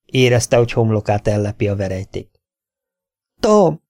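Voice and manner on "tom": a much higher, louder cry of his name; joyful shout. Acted. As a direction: call out to him in sudden relief. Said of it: "kiáltotta Anna". (Anna örömmel, hangosan Tom nevét kiáltja)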